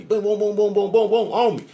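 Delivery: high, rapid excited booms; excited rush. Real, not acted. Man telling viewers the voices hit him, excited high booms. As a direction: pile the words on like a flood. Spirits all talking at once.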